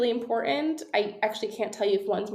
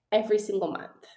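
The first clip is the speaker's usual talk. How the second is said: ordinary talk, nothing marked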